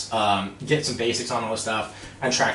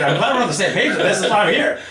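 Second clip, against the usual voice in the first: high, loud, quick reply through laughter; laughing retort; 9 semitones higher, 6 dB louder, faster than usual. Retold or imitated. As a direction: cheerfully agree you're an idiot, laughing. Laughing retort to being called idiot.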